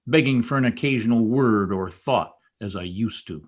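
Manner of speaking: ordinary delivery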